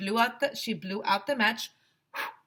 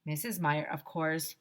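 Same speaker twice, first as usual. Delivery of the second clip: ordinary delivery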